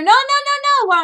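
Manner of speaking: high, loud pleading no; high loud protest. Retold or imitated. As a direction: cry out no, pitched high. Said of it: "Wu is telling her".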